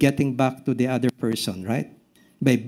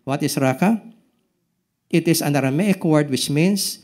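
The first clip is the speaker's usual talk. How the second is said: ordinary talk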